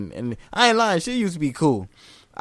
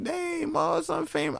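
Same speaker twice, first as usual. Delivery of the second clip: amazed exclaim; 5 dB quieter, slower than usual. Retold or imitated. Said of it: "I be like".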